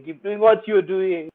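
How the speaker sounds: ordinary delivery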